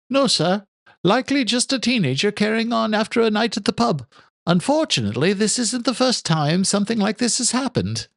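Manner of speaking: put-on earnest young groundskeeper calling the police; young son on the phone. Son's voice in story.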